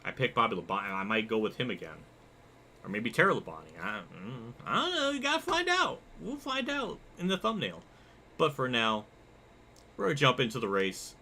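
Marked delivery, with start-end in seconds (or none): 4.6-7.8 very high put-on squeaky voice; mock-coy, high-pitched dodge; playful teasing. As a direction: tease it in a silly falsetto. Playful teaser for next pick.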